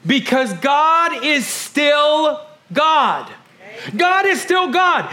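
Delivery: preaching. Acted. Pastor preaching to his family, full force. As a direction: proclaim it to the congregation.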